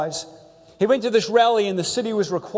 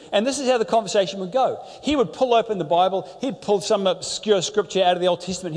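normal talking voice

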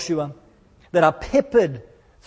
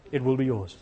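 much lower and softer, a grand fatherly king; Lion King voice; 7 semitones lower, 6 dB quieter, faster than usual. Retold or imitated. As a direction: rumble it low like the lion king showing his son the land. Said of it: "That was my Lion King impersonation".

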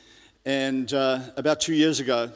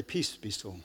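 soft and calm; hushed assured calm; quiet confidence; 6 semitones lower, 11 dB quieter than usual. Shown as a demonstration. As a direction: say it softly, no need to shout. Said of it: "Just need to have a quiet confidence in God".

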